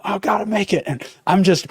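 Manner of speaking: high, breathless gasping; acted panting. Traveler running for the gate with a pack, mock out of breath. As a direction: mimic running flat out, gulping air. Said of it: "I gotta start breathing hard".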